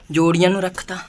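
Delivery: ordinary delivery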